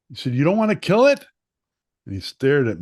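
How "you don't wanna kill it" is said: much higher, much louder threatening question; dad looming over his son; menacing dad. Retold or imitated.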